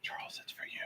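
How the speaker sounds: soft breathy whisper. Real, not acted. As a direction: whisper it barely aloud. Whispered aside to co-host.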